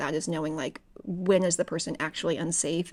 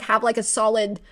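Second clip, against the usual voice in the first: ordinary talk, nothing marked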